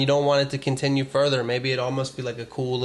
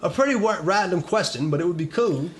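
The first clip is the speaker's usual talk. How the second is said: bad British accent; 3 semitones higher than usual. Reading a fan's letter in shaky British accent. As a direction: fumble through a British accent. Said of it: "I suck my accent so bad".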